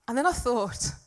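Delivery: ordinary delivery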